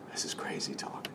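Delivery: hushed, whispered aside. Real, not acted. Preacher confiding to congregation how mad this sounds, whisper. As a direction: whisper it under your breath. Confiding shock at Jesus' words.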